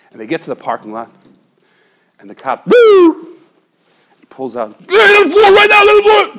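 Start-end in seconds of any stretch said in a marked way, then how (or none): 2.7-3.4 shout. Retold or imitated. Police burst in. 4.9-6.4 shouted command. Retold or imitated. Police order at gunpoint.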